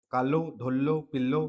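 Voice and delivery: कालो, धोल्लो, पिल्लो with the l curled back and doubled, ending in o; Rajasthani retroflex l. Shown as a demonstration. Teacher reading the written words aloud to students, retroflex ळ in कालो, धोल्लो, पिल्लो. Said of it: "इस, ऐसे लिखते हैं देखो".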